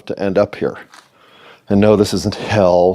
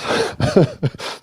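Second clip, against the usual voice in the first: imitated laugh; 8 semitones higher, 4 dB quieter than usual. He laughed, retold.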